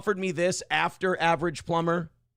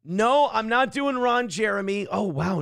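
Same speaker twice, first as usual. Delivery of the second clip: ordinary talk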